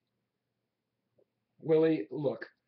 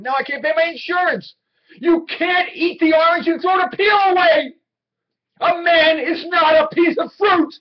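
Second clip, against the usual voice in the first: rising to a desperate, full-throated shout, much higher and louder; furious outburst; 10 semitones higher, 11 dB louder than usual. Acted. Willie begging his boss Howard for his job, furious shout. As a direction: hurl every line at him like a man being thrown away. Salesman pleading with boss, erupting.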